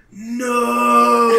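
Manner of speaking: high, loud cry of fear; terrified no. Acted. You screaming at the axe overhead. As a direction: recoil from the blade. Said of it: "blade over your head".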